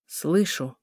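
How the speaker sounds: chilly, understated answer; cold, withdrawn mother; icy reply. A narrator's reading. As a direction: shut her out with a cold word. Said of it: "ответила женщина таким тоном, что сердце дочери покрылось морозной коркой".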